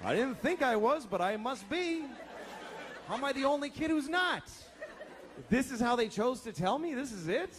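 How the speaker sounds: put-on high-pitched, incredulous kid's protest; acted dismay. Kid arriving at the wrong camp, dismayed disbelief. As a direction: play stunned disbelief, pitched well up. Child's shocked realisation at camp.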